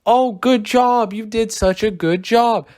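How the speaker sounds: high, drawn-out mock praise; hammed-up high nurse voice; creepy nurse. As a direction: imitate an overbearing nurse cooing at a little kid. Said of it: "talking with a nurse or something".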